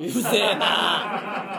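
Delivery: long drawn-out annoyed groan of words; slow, fed-up heckle; comic irritation. Acted. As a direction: stretch every vowel in exasperation. Heckles the listener's letter.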